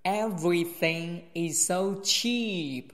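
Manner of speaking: slow phrase with "cheap" drawn out; lengthened CHEAP. Shown as a demonstration. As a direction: stretch "cheap", then stop. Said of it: "拉长一点，cheap". (拉长"cheap"再停顿)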